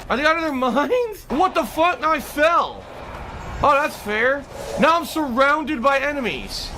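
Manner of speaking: high-pitched indignant griping; real exasperation. Real, not acted. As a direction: protest in outraged disbelief at the game. Outraged at the game while playing.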